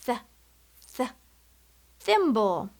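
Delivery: "Th, th, thimble" with the tongue between the teeth; th sound demo. Shown as a demonstration. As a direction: model "th" then the whole word. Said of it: "You try".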